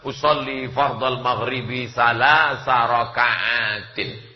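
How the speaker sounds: solemn slow recitation of the niyyah; prayer intention recited. Shown as a demonstration. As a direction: intone the prayer intention slowly. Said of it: "nggak mungkin dia bisa sholat".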